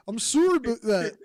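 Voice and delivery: confident Canadian accent demo on "sorry about that". Shown as a demonstration. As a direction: show off a Canadian apology. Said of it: "I have amazing".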